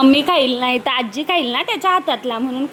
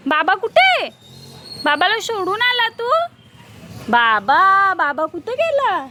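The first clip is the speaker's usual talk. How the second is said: put-on higher singsong voice coaxing a toddler; coaxing baby talk; 5 semitones higher than usual. Mother coaxing her toddler to say dudhu, in a high singsong. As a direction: lilt each line up to get the baby to talk. Asking baby where papa went.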